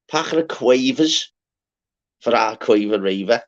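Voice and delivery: ordinary talk, nothing marked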